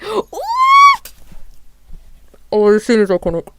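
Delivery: loud, thrilled gush over the scene; squealing fan excitement; excited squeal. Real, not acted. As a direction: burst out with fan delight. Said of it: "immediately squealed".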